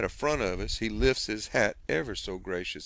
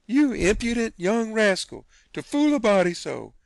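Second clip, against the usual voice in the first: high, loud happy rebuke; aunt overjoyed at being fooled; delighted scolding; 11 semitones higher, 7 dB louder than usual. Acted. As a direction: scold him while laughing inside. Jumping to hug him.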